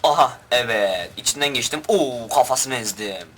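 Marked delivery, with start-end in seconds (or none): none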